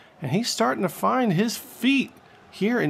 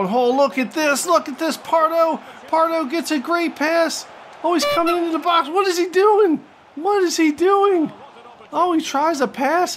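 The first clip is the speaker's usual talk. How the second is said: frantic play-by-play; 10 semitones higher than usual. Real, not acted. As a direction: rise from thrilled to exasperated disbelief, pitch way up. Last-minute chance squandered.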